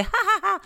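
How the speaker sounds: high-pitched imitated laugh; mock cackle. Intern after pulling a few ponytails, mock high laugh. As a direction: put on a high, silly "ha ha ha".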